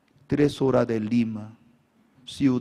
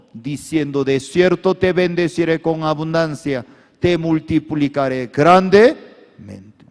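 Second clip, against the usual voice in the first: loud preaching of the promise; fervent sermon; 5 semitones higher, 9 dB louder than usual. Acted. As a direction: proclaim it with conviction. (proclamación ferviente)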